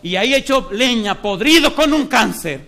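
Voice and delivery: alto y fuerte, lento, clamando; predicación a plena fuerza. Acted. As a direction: clama a la congregación como un predicador encendido. (predicador encendido ante la congregación, clamando)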